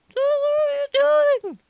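loud, high scolding mother; angry mom. Acted. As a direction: yell at your kid as the mom. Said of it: "my mom heard again".

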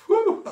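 high, loud whoop of a breath out; a real joyful whew; fired-up preacher's whew; excited whew. Real, not acted. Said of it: "I'm feeling Pentecostal this morning".

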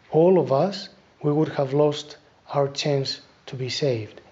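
normal talking voice

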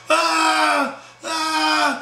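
high, drawn-out "ah", twice; mock scream. Storyteller mimics Brian's girl at the fight, mock scream. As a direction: imitate a screaming woman with long high wails. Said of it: "Sophia screaming".

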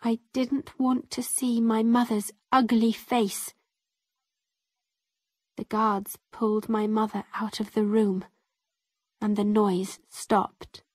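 ordinary delivery, nothing marked

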